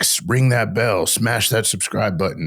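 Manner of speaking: slow, hammed-up YouTuber plug. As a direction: mimic a YouTuber begging subscribers. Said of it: "They're like".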